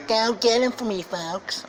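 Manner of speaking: high-pitched mocking pathetic voice. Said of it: "speak with that pathetic tone of voice".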